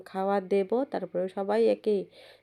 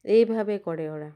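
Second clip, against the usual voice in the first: ordinary delivery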